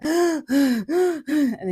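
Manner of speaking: gasping for breath. Retold or imitated. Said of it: "we're like".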